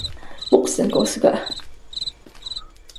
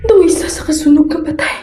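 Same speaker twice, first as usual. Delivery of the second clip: loud, high panicked telling of the ghost; panicked horror; 4 semitones higher, 8 dB louder than usual. Acted. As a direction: gasp out the horror as it unfolds. Woman ghost behind him. (nagakurog nga kahadlok, mabaskog)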